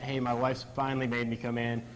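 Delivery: ordinary talk, nothing marked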